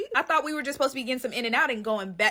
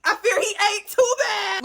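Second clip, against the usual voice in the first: gushing; 8 semitones higher, 4 dB louder than usual. Real, not acted. Raving about actor.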